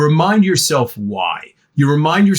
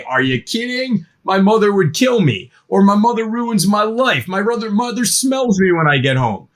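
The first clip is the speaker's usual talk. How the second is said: put-on raised, dramatic teen complaining; teen excuse; 7 semitones higher than usual.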